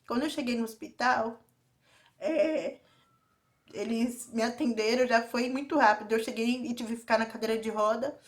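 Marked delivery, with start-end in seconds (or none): none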